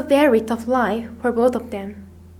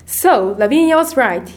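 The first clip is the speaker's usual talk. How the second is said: stern, accusing tone; angry; 4 dB louder than usual. A narrator's reading. Miss Minchin catches the girls at the party, angry. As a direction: accuse the children angrily. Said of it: "she said angrily".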